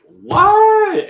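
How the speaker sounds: a loud high drawn-out what; shrieking what. Retold or imitated. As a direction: scream it in total disbelief. Her own shocked reaction retold.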